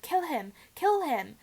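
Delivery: villain yelling for the kill. Acted. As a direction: yell it twice, frantic. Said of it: "Voldemort's yells of".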